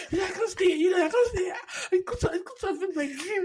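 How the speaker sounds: high, laughing imitation of a scared friend; mock panic. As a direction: play her fear of the snake half-laughing. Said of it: "at first she's like".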